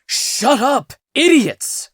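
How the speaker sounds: injured man yelling at friends to hurry; panicked yell. Acted. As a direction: bark at them in a panic. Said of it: "cried Vamenos".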